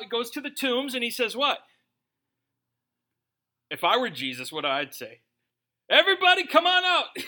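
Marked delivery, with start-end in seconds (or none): 5.9-7.2 high, loud comic summons; big mock shout calling everyone out; crying out. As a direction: holler it to a whole graveyard. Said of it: "he goes and he cries out".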